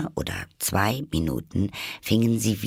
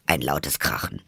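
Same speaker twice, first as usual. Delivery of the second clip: plain reading voice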